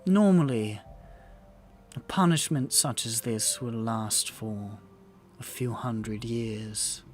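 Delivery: low, soft regal voice with long pauses; grave, deliberate queen, weighing each phrase; stately queen. Acted. Queen grudgingly conceding to a player character, low and measured. Game master plays the queen.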